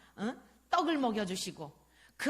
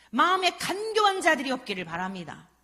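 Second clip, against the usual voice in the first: emphatic blessing; 4 semitones higher, 9 dB louder than usual. Real, not acted. Preacher's emphatic closing blessing.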